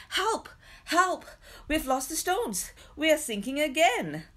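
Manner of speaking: high, loud panicked cries for help; frightened yells. A narrator's reading. Sinking travellers shouting to the rescuer, panicked yells. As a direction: yell as the marsh pulls you down. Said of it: "The shouts came from Trickery".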